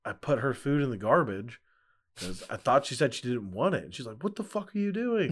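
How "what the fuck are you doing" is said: high-pitched mock outrage; imitating mad wife. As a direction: act out her indignant demand, pitched up. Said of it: "she got really mad about it".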